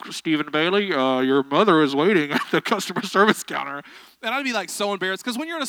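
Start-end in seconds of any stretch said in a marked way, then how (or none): 0.1-3.8 store intercom announcement. Retold or imitated. Preacher imitating store staff paging a lost child, loud intercom voice. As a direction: announce it over a shop loudspeaker. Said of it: "they'd be like".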